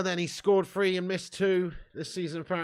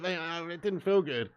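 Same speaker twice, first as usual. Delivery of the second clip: normal talking voice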